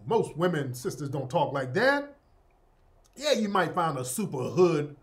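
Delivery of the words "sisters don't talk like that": normal talking voice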